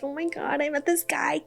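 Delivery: high, hushed mock gush; giggly crush confession. Telling friends about a new crush, giggly crush confession. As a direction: confide it softly and high, tittering like someone smitten. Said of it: "giggle when you're around your crush".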